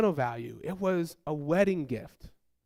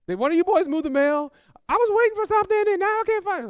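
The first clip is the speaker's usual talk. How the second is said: very high, loud imitated complaint; exasperated wife; 13 semitones higher, 6 dB louder, faster than usual. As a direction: build to a high, rushed mock outburst. Exasperated wife hunting for mail.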